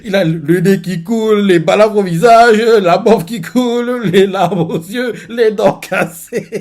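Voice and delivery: a touch of giggling; amused. Real, not acted. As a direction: describe the sorry state while cracking up. Describing pitiful Baggy, amused.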